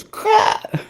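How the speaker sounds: squeaky high panicked yelp; panicked inner voice. Retold or imitated. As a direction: blurt it as your mind panics. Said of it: "your mind goes".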